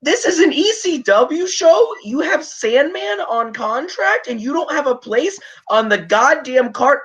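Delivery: high-pitched, incredulous outburst; indignant rant. Real, not acted. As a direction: rant in disbelief at a stupid booking decision. Ranting about Sandman left off card.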